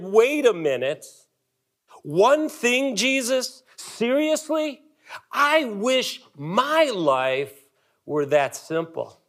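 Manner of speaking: rising high, slow "seriously?"; incredulous disbelief. Retold or imitated. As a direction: question Jesus in disbelief. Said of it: "here's what I wrote. I said".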